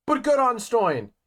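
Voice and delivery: high, loud praise; excited cheer. Real, not acted. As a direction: cheer for him. Cheering for the winner.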